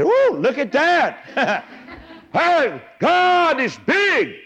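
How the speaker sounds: put-on very high, drawn-out childlike wonder; amazed little kid. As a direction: squeal it slowly like an awestruck child. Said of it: "I made a little kid say".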